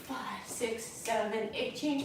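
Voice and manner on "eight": ordinary talk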